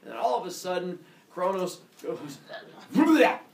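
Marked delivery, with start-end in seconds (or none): 2.9-3.4 loud, high retch; mock vomiting. Kronos suddenly throws up, loud mock retch. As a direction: hurl out a comic puking noise. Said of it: "and throws up".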